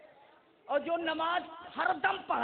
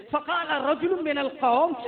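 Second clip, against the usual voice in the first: normal talking voice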